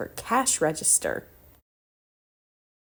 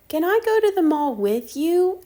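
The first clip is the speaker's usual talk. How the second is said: eager little sister; 10 semitones higher, 5 dB louder than usual. Acted.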